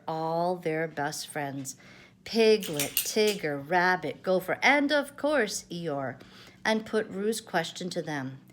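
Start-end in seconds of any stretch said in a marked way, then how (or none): none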